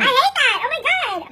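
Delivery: high, loud cry of dismay; real exasperated yelp; loud outburst. Real, not acted. Startled outburst during makeup.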